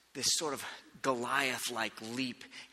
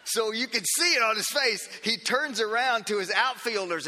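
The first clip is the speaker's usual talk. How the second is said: laughing; 6 semitones higher, 5 dB louder than usual. Real, not acted. Laughing while telling.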